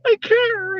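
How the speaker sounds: high crying wrestler persona; high falsetto mock tears; in character. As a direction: squeal it out, overcome, staying in character. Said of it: "I tried to stay in the character".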